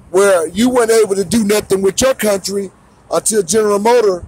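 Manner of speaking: yelling the grievance at the camera; heated ranting shout. Real, not acted.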